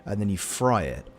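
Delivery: ordinary talk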